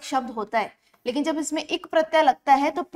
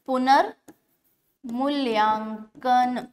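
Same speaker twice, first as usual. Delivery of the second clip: पुनर्मूल्यांकन stretched syllable by syllable; slow motion; much slower than usual. Shown as a demonstration. Teacher spells the long word out for students, slowly. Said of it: "slow motion में बोल के देखिए".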